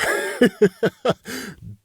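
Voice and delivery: long run of chuckles; drawn-out high chuckle; amused laugh. Real, not acted. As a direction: break into a laugh mid-quote. Amused mid-way through Shelly's order.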